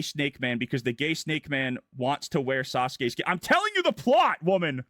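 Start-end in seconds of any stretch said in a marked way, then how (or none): 3.3-4.8 much higher and louder, fed up with being interrupted; exasperated snap. Real, not acted. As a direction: bark it at her, annoyed. Snapping at an interrupting listener.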